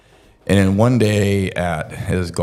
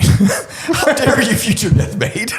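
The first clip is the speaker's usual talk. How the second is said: very high, slow, laughing mock outrage; mock outraged wife; slower than usual. Ex-wife confronting him at the golf club, hammed-up outrage. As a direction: stretch it out in a shrill fake outrage while cracking up. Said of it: "And she's like".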